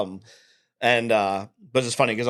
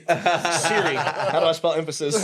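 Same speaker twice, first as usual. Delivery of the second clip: higher voice, laughing through the question; laughing mock query; 4 semitones higher than usual. Joking to podcast hosts about a hard shop name, laughing mock query. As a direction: act out a helpless plea to a phone assistant. Acts out asking Siri, laughing.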